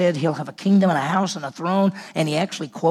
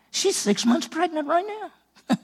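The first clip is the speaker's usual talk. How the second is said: playful surprise; 12 semitones higher than usual. Acted. Angel's surprising news, comic retelling.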